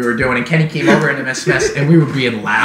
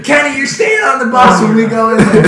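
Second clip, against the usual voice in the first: raised mock scolding voice; bus driver scolding; 9 semitones higher, 3 dB louder than usual. Bus driver punishing Kenny, mock scolding. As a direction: play the stern driver, half in jest. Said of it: "bus driver scene".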